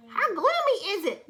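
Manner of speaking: ordinary delivery, nothing marked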